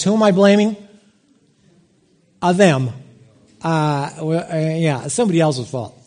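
ordinary talk, nothing marked

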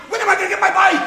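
put-on a kid shouting at his parents; demanding yell.

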